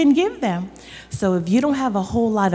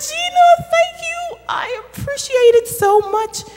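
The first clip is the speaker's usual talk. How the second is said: much higher, thrilled thanks; delighted mom; 4 dB louder than usual. Acted. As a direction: gush with delight. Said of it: "She's like".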